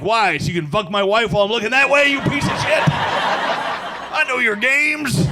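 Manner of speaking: hammed-up jealous rant; mock outrage. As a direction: accuse the doctor in mock fury. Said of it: "And you're like".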